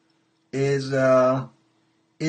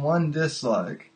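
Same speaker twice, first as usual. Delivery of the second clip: usual speaking voice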